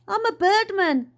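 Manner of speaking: high, loud, indignant retort; snapping. A narrator's reading. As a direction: snap back, insisting on it. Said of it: "snapped Dad".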